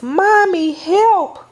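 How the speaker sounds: put-on panicked little-kid cry for help; frightened child crying out. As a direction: call for mommy as the dog runs at you. Child sees big dog running.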